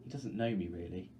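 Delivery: ordinary delivery, nothing marked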